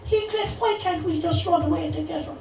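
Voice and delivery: Cathy in a high, bad Cork accent; high-pitched hammed Cork Cathy; dodgy Cork accent. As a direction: call out in a cheesy Cork lilt. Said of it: "delivered in a dodgy Cork accent".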